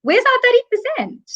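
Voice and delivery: mock demand to the government; demanding women. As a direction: take on the voice of women demanding their share.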